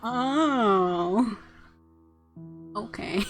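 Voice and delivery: normal talking voice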